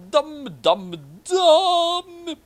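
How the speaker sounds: high, loud mock cry; mock wailing. Narrator closing the chapter, loud high mock wail. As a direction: wail the word like a cartoon.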